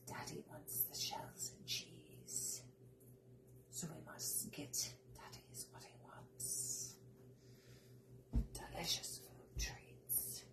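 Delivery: slow, very hushed whisper; barely audible, drawn-out whisper. Pretend. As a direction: whisper it like a little secret aside.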